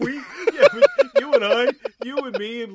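high, loud talk through laughter; real laughter breaking into the words; laughing. Real, not acted. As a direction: crack up while trying to get words out. Cracking up at quoted line.

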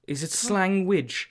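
"slangwich" stretched out slowly and high; slow, high-pitched questioning "slangwich"; drawn-out pun. Real, not acted. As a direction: sound out "slangwich" as a discovery. Slowly savours his coined pun.

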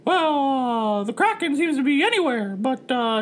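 high, hesitant mascot voice; worried cartoon mouse. First mate reports tentacle to captain, high cartoon mouse. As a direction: squeak out the bad news politely. Plays Mickey the rat.

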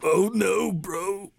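very high silly put-on; squeal-high clowning bro voice; goofy cartoonish voice. Host trading silly voices with co-host, goofy and high.

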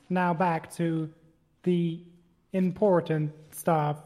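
slow, drawn-out delivery; slow talking. Real, not acted. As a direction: stretch the words out slowly. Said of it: "I don't know why I'm talking so slow".

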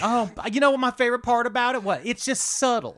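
put-on high, quick Southern belle accent; Southern lady. Spy flattering locals about the Maryland flag in a high Southern belle accent. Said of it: "She's pretending to be a Southern lady".

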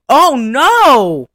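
loud, high mock dismay; hammed-up dismayed wail; horrified reaction. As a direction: cry out in exaggerated horror. Acts own horrified reaction.

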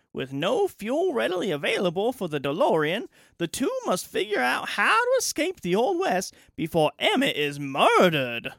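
put-on high, bright old-timey radio narration; 1950s radio announcer. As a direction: read it like a vintage newsreel announcer. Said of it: "Thank you, nineteen fifties radio announcer".

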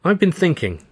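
serious. A narrator's reading. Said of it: "a serious expression on her face".

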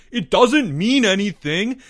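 high, loud protesting wail. A narrator's reading. Albert defends his words to the boys, wailing. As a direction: keep wailing, pitched well up. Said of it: "Albert wailed".

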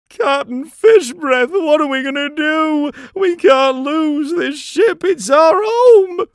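very high, loud, drawn-out wail; panicked cry. A narrator's reading. Pirate begs the captain as the race slips away, panicked cry. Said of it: "One of them cried".

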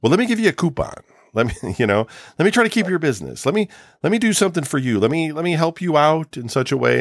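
hammed-up eager salesman begging to keep you; mock placating clerk. Mocking businesses placating upset customers.

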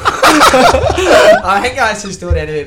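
slow, high, loud laughing words. Real, not acted. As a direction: laugh your way through it. Laughing at the end of his story.

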